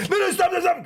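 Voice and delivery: high, rapid-fire begging; mock panicked plea. To a friend making himself vomit, mock panicked plea. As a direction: plead in comic alarm for him to quit.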